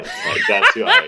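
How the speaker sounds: high laughing shout; laughing outburst. Real, not acted. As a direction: burst out laughing mid-sentence.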